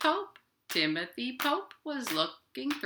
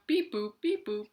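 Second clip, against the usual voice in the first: robot beeps. Acted. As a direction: beep and boop like a toy robot. Robot noises between sung lines.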